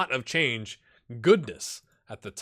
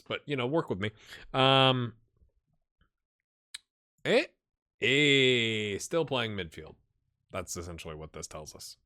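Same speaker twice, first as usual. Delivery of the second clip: normal talking voice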